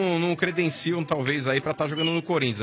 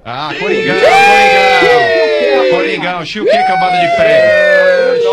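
huge stretched football chant, much higher; cheering shout; 14 dB louder, slower than usual. Real, not acted. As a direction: scream the team's name like a fan. Host cheers for Corinthians. (grito de torcedor)

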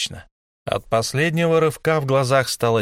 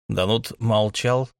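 ordinary delivery

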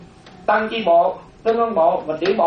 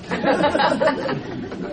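high breathy burst of laughter; 8 semitones higher, 3 dB quieter than usual. Real, not acted. Teacher laughing at his own piano-brand pun, high laugh. Joke about Yamaha spelling.

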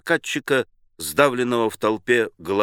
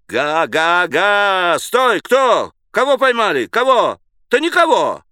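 high, raised, jostling cries; agitated overlapping-style exclamations; crowd clamour; 7 semitones higher, 4 dB louder than usual. A narrator's reading. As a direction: shout like an excited mob. Crowd clamours during a chase.

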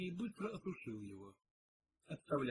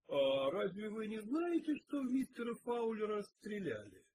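surprised; 7 semitones higher, 4 dB louder than usual. A narrator's reading. Detective to kitchen staff about the shooting, surprised. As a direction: ask in open surprise, pitched up. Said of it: "удивился Пил".

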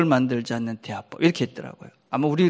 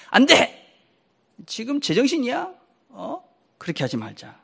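mock harsh refusal; 6 semitones higher than usual. Shown as a demonstration.

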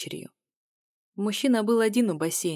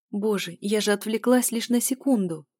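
ordinary delivery

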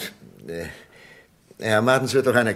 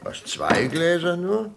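surprised; 10 semitones higher, 3 dB louder, slower than usual. Acted. Only two glasses brought.

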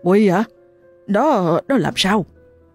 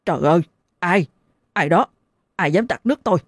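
startled; faster than usual. Acted. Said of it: "giật mình bật dậy hô hoáng".

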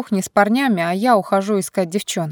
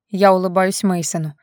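usual speaking voice

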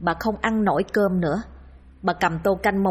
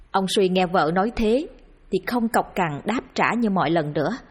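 ordinary delivery

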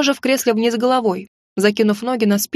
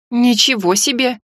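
stunned exhale. Acted. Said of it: "обалдело выдохнула я".

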